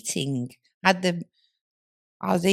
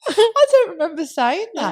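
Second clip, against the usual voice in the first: laughing; 11 semitones higher, 4 dB louder than usual. Real, not acted. Laughing denial.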